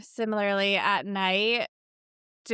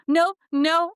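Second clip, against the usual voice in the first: playful insisting; 8 semitones higher, 8 dB louder than usual. Retold or imitated. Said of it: "and I'll go".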